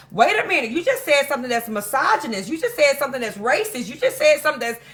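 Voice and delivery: high-pitched imitation of indignant objectors; mock outraged callout. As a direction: pile up accusations, pitched high. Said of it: "they will get on your ass and be like".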